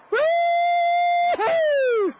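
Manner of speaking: long, high, loud falling whoop of joy; announcer's jubilant whoop as the title is won; excited whoop. Real, not acted. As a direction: let out a big victory woo-hoo. Crowd-winning shot, announcer celebrates.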